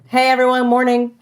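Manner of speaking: bright-pitched, friendly greeting to the room; high warmth. Shown as a demonstration. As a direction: greet everyone warmly, pitch lifted high. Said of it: "you just signaled high warmth".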